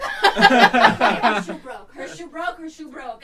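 slow laughing words that fade out; laughing repetition. Real, not acted. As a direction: repeat it while laughing, trailing away. Repeating in disbelief, fading.

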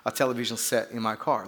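put-on low, soft British accent, a touch annoyed; badly faked British with understated irritation; annoyed mock British. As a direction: play a miffed British Rolls driver, quietly. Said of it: "starting to get annoyed".

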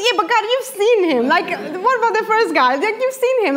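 high, fast pleading to God; pleading protest. Retold or imitated. To God about the rude driver, high fast protest.

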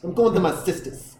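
put-on caricatured Boston accent; thick Boston accent. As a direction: imitate a Boston girl's accent. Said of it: "Boston girl's mouth when it's that thick accent".

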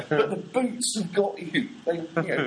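normal talking voice

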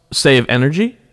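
ordinary talk, nothing marked

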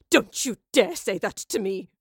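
loud, seething threat; furious warning. A narrator's reading. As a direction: warn them off in a fury. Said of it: "now a cauldron of fury".

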